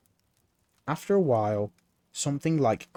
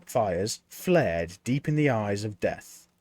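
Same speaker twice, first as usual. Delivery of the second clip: plain reading voice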